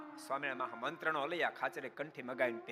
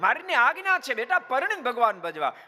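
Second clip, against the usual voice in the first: higher, louder firm reminder; stern calling out; 8 semitones higher, 7 dB louder than usual. Retold or imitated. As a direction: call out to the boy, firm. Aliya Khachar scolds the son. (ઊંચા અને મોટા અવાજે કડક ટકોર)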